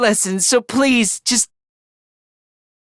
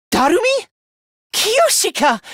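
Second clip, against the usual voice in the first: high, startled calling of names; astonished recognition; 7 semitones higher than usual. Acted. Fighter finding lost friends alive, astonished. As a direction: call out in high disbelief.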